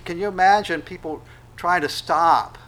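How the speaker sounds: ordinary talk, nothing marked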